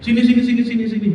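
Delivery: high, urgent-sounding beckoning; acted mother's "come here"; mother calling child over. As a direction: usher a child in with quick repeats. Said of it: "dipeluk sama maminya".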